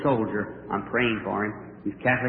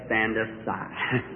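curt grandma; 6 semitones lower, 8 dB quieter, much slower than usual. Retold or imitated. Grandmother brushes off help.

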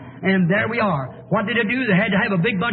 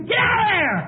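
urgent yell, pitched way up; screamed warning; 13 semitones higher, 4 dB louder than usual. Acted. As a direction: scream a warning to someone heading for the falls. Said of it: "I'm screaming to you".

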